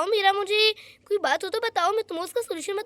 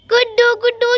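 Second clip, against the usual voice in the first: calling out; 4 semitones higher, 6 dB louder than usual. Acted. Sonia calling Guddu to play.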